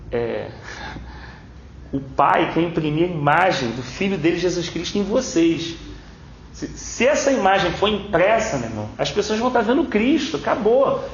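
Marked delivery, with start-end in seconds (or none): 1.9-11.0 rising preaching. Acted. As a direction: drive the point home like a preacher.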